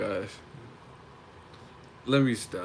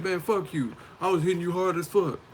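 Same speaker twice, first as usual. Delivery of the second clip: higher-pitched defiant comeback; mock bravado; 7 semitones higher than usual. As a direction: toss it back, cocky and higher. Acts out adrenaline-fuelled comeback.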